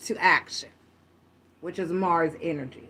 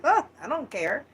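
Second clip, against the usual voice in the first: mock offhand brush-off, pitched higher; dismissive; 7 semitones higher than usual. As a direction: toss it off like someone who walks away unbothered. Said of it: "walk away like".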